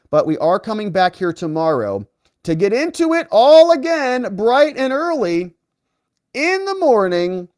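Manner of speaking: sing-song announcer sign-off, stretched; upbeat outro. Acted.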